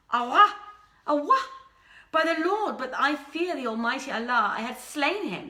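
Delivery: loud angry cries of Awa easing into a tense threat; furious outburst fading. A narrator's reading. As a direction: explode in outrage, then simmer down. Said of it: "she slapped her face".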